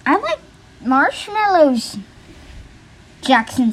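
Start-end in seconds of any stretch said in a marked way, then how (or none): 0.0-2.0 higher, drawn-out sample story line; mock-up of a narrated character line, pitched up; narrator example. Shown as a demonstration. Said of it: "a narrator who says, like".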